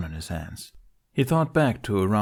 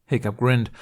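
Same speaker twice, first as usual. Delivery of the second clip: ordinary delivery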